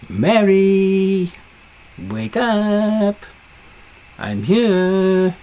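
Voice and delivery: high, held, sing-out call; drawn-out calling. Retold or imitated. Voicing Jesus calling Mary at the tomb, high drawn-out call. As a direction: call a name as if to rouse someone. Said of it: "spoke the word".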